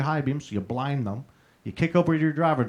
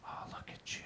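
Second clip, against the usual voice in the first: whisper; 22 dB quieter than usual. Retold or imitated. Gloating over sleeping brother.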